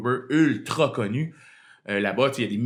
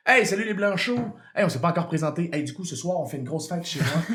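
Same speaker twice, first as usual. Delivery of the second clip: mock Québécois script; 3 semitones higher than usual. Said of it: "il fallait que je dise".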